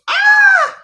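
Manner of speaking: very high, loud cry; loud exclaimed "ah". Real, not acted. Host reacts to the man's downfall, high loud cry. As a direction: let out a sudden high yelp.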